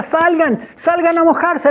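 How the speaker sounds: high, stretched summons; excited calling out. Retold or imitated. Man calling a couple out to get wet, excited holler. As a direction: urge them out into the rain.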